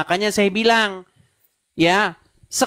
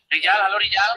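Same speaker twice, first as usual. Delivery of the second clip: echo; 2 semitones lower than usual. Real, not acted. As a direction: voice echoing back through the call. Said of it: "Suaramu menggema".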